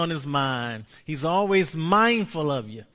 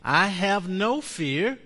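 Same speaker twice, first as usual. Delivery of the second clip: slow proclaimed confession; 4 semitones higher, 4 dB louder, slower than usual. Shown as a demonstration. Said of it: "Say this with me".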